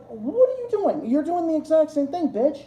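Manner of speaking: what are you even doing, disgusted; incredulous outrage. Real, not acted. Ranting about the ex-friend, incredulous high outrage.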